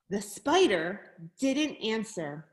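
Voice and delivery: ordinary delivery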